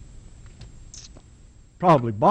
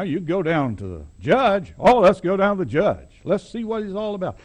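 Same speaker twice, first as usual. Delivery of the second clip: put-on higher, coaxing old boss voice; ingratiating old man; 3 semitones higher than usual. Preacher voicing the old boss man coaxing the boy. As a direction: soothe the boy while laying down the law.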